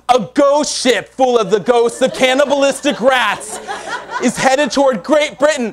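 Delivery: high, loud, laughing doom headline; hammed-up alarm. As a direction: cry it out like a horror trailer. Spooky mock news headline.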